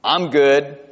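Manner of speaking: "I'm good" in a lightly drawled South Georgia way; South Georgia accent. Shown as a demonstration. Pastor voicing a content sheep in South Georgia accent. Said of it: "this is how I would say it in South Georgia".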